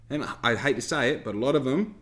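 normal talking voice

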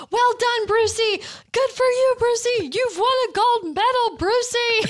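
high, loud crowd cheering; cheering shout. A narrator's reading. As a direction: yell it like cheering children. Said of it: "yelling and clapping and shouting".

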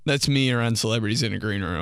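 usual speaking voice

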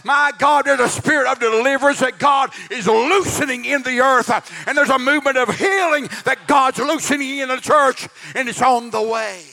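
preaching at full force. Acted. Preacher promising the congregation deliverance, preaching at full force. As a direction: whip the congregation up with rising fervour.

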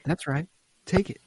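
put-on high, slow coaxing voice, like to a baby; coaxing dad. Din urging Grogu to take the ball: high coaxing. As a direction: lift the voice and draw it out to encourage.

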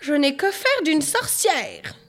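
high, slow, cross motherly voice; growled scolding. A narrator's reading. Mother rejects a witch daughter, cross scolding. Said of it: "gronda la maman".

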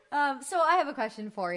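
talking through real laughter; high giggly laugh. Real, not acted. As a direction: still cracking up while asking. Laughing after murder joke.

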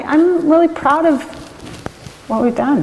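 real, high-pitched choked emotion; proud, fighting back tears, voice lifting high; choking up. Real, not acted. Winemaker asked what she's proudest of, choked up. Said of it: "I'm gonna get choked up".

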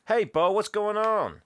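put-on high, slow boyish Jack voice; voicing Jack. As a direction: lightly play the kid greeting a friend. Retelling film dialogue, Jack voiced.

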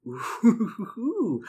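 high, laughing excited "ooh". Real, not acted. Player thrilled by the long shopping list, excited ooh. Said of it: "sorry, I just get so excited".

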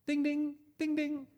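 high, sing-song phone chime; two high "ding, ding" chimes; imitated text alert. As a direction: mimic a message tone, pitched right up. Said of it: "My text message arrives".

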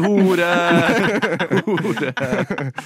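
høyt, langtrukket rop, imitert med latter; imitér en rusa fyr som skriker, høyere i tonen; gjenfortalt skrik. Retold or imitated. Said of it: "begynte han å skrike". (leende etterligning av skriking)

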